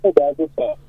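muffled caller far from the mic; muffled phone. Real, not acted. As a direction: sound muffled, away from the phone. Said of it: "you're a little muffled".

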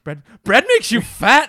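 loud, high, slow disbelieving question; incredulous quote. Retold or imitated. Film character reacting to the garlic bread claim, loud and incredulous. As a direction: shout it in outraged disbelief. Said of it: "he's like".

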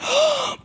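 high, sharp gasp of awe; starstruck gasp. Retold or imitated. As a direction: gasp at seeing your idol. Starstruck greeting of idol retold.

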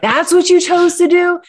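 high, incredulous mock scolding; a parent teasing disbelief at a kid's choice; exasperated parent. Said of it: "you say to your kids".

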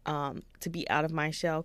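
ordinary talk, nothing marked

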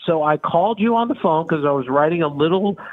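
normal talking voice

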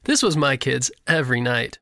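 ordinary delivery, nothing marked

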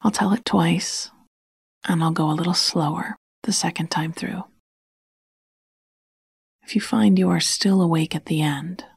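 ordinary delivery

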